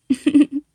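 bright burst of laughter; sudden giggle. Acted. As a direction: laugh out sharply.

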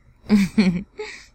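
loud taunting laugh; mocking laugh. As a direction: laugh right at him. Laughs at listener.

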